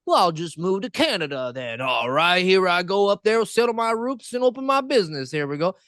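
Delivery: lightly mocking a carefree American moving north; naive American. Mocking Americans who think they can move to Canada, breezy high voice. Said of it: "Americans are just like".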